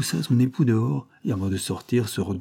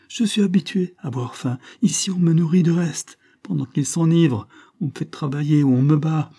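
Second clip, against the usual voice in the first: put-on high, raised child's voice; impassioned pleading; 8 semitones higher, 5 dB louder than usual. Chiffon describing hunger and beatings, pleading hotly. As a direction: argue urgently, voice pitched up.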